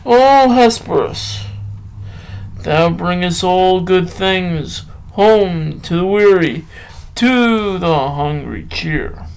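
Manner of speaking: slow, measured poetry reading with long pauses; poem recital. Acted. Reading a Byron poem to listeners, slow recital. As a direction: let each line breathe like a recital.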